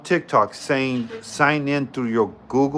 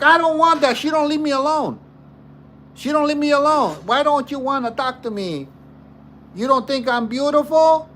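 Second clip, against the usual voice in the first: retold friend in a much higher, whiny voice; 12 semitones higher than usual. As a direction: play the friend high and pleading, like he's being hounded. Friend's whiny reply in story.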